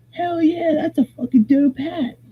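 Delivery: high, eager dude voice; excited stranger. Retold or imitated. Stranger at camp gushing over the hat, high and eager. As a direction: pitch it way up like a stoked bro.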